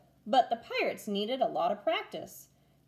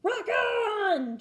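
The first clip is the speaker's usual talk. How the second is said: much higher cheer; cheering crew; 11 semitones higher, 4 dB louder than usual. A narrator's reading. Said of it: "cheered the crew".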